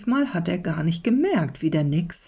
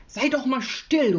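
put-on irritated boy, pitch raised; annoyed call; 7 semitones higher than usual. A narrator's reading. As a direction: call out in mild exasperation. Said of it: "ruft Jonathan".

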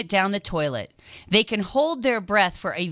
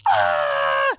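startled shriek. Retold or imitated. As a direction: yell in shock at the rat. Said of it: "I realized I went".

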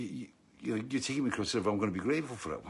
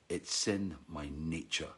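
slow, softer, lower punchline; understated, matter-of-fact admission; resigned confession; 4 semitones lower, 6 dB quieter, slower than usual. Retold or imitated. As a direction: let it fall quietly and slowly as the scorpion.

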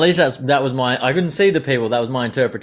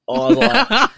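laughing; 13 semitones higher, 5 dB louder, slower than usual. Real, not acted. Laughing while retelling.

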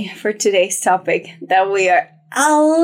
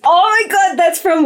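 excited gasp; 7 semitones higher than usual. Retold or imitated. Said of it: "She said in parentheses".

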